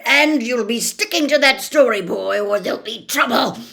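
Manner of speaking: loud spitting threat; spat. A narrator's reading. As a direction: spit the words out. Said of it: "spat Uncle Vernon".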